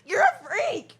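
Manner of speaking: high, loud laughing disbelief; laughing mock outrage. Real, not acted. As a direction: tease through laughter.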